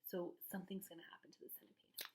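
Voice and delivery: hushed fast aside; whisper. Real, not acted. Aside to listeners.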